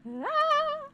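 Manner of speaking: a real high squeal of delight at the pony; excited squeal. Real, not acted. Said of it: "I'm screaming".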